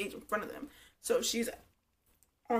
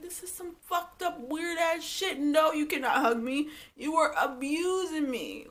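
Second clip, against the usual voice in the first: indignant; 7 semitones higher than usual. Real, not acted. Own indignant reaction to the show.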